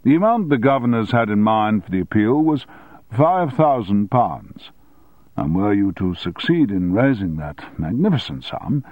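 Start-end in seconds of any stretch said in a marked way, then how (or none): none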